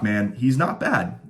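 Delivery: ordinary talk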